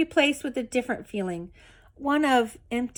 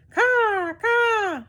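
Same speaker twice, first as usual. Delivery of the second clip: much higher, cawing like a crow; crow caws; 12 semitones higher, 4 dB louder than usual. A narrator's reading. Crow cawing in the rabbit's ear, high-pitched. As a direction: screech each caw like a bird. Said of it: "said the Pellegrino crow".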